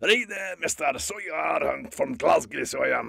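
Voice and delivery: rough Glasgow accent; Scottish accent. Shown as a demonstration. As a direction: put on a broad Glaswegian. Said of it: "Shall I do some Scottish?".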